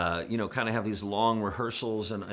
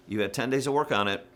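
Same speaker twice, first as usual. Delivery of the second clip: usual speaking voice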